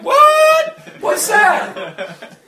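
a sudden high, loud yelp of surprise; shocked what. Retold or imitated. Preacher retelling his shock at the Spirit, high startled cry. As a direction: jump up in pitch, stunned by what he heard. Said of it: "I'm like".

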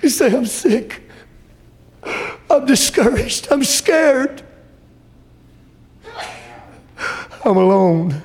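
high, pleading cry of despair; despairing believer. Retold or imitated. A sick, scared believer crying out, high and pleading. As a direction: cry out like someone at the end.

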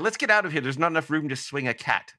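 put-on a light English Swindon accent. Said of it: "I do a bad [name] impression".

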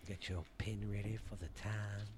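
whispered playful cue; playful hushed aside. Pretend. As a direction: whisper it like a secret instruction. Hushed aside before the reading.

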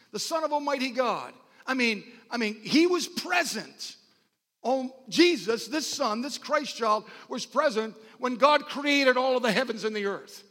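loud, high-pitched preacher proclamation; forceful preaching. Real, not acted. Preacher proclaiming the Son present at creation, forceful preaching. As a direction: proclaim it with rising conviction.

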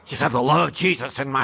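tongue-in-cheek saintly boast; sarcastic piety. Retold or imitated. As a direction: ham up the holy act ironically. Sarcastic self-mockery of the pastor.

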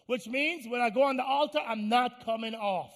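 high, loud, commanding pulpit shout; full-force preaching. Acted. Preacher orders the body to submit, in forceful high shouting. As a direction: tell the body off at full volume.

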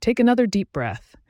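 measured guided-visualization delivery with long pauses; guided meditation. Acted. Host guiding listeners into a relaxing visualization, calm meditation voice. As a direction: guide the listener gently through each image, pausing between steps.

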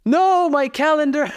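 high, slow fake excuse; mock excuse. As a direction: stall with a squeaky, drawn-out excuse. Dodging the dentist's offer.

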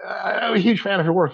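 lightly voiced flustered fan meeting his idol; starstruck fan. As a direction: gush nervously at your hero. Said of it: "I'd be like".

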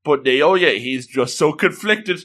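ordinary talk, nothing marked

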